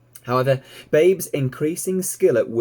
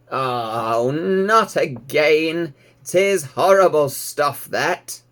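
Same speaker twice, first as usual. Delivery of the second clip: put-on slow, louder weary old sheep complaining; grumbling old ewe; 4 semitones higher, 5 dB louder, much slower than usual. A narrator's reading. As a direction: groan it out like a reluctant old ewe. Ma dislikes medicine.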